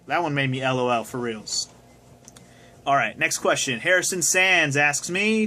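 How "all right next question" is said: usual speaking voice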